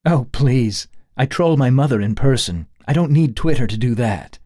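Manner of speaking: lighthearted snap. Acted. Daughter joking about trolling her mother, lighthearted. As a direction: tease back with a light jab. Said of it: "Sharita snapped back lightheartedly".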